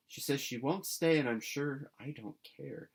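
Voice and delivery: plain reading voice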